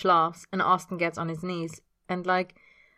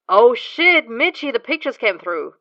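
excited; 7 semitones higher, 11 dB louder than usual. Acted. James excited by pictures.

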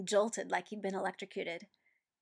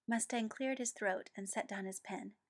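ordinary delivery

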